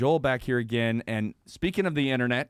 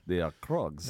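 put-on Russian accent; 8 semitones lower, 5 dB quieter than usual. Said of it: "You guys are very good at it".

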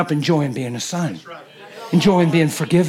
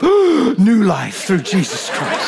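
gasping up; much slower than usual. Acted. Gasp rising from baptism water.

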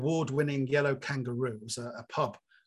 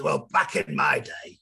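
put-on lower, slow grumpy old-timer; mock a grumbling veteran, drop the pitch; 8 semitones lower, 4 dB louder, slower than usual. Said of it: "I hope I didn't come over as".

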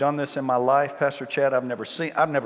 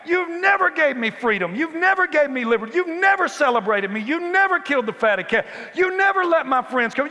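much higher and louder, indignant shouting; angry older brother complaining; 14 semitones higher, 6 dB louder than usual. Retold or imitated. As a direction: yell the grievance like a wronged son. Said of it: "And he says".

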